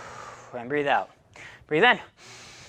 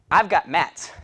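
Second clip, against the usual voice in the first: normal talking voice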